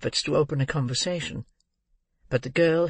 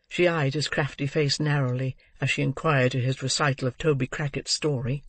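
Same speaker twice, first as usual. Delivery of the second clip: ordinary delivery, nothing marked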